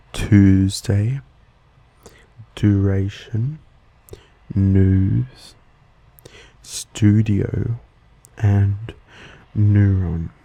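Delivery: American flat "oo" in "news" and "neuron"; American accent. Shown as a demonstration. Host demonstrating the American way, American accent. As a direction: say "Tuesday" as "Toozday", American style. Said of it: "The American way to say them would be".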